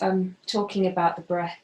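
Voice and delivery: ordinary delivery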